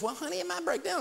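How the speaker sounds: a lightly mocking take on a worried wife; imitating wife. Husband voicing his wife's worried warning, high and mock-sweet.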